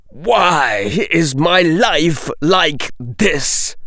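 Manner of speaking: high, slow exasperated moan; despairing lament.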